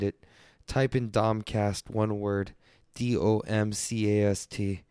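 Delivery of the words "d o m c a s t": usual speaking voice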